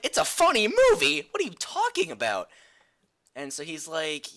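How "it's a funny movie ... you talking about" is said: much higher and louder disbelief; incredulous outburst. Retold or imitated. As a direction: shriek it back in disbelief. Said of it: "I'm like".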